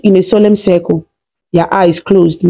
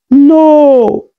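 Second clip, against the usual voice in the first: a high, louder cry of grief; anguished cry; 7 semitones higher, 3 dB louder than usual. A narrator's reading. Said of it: "she cried".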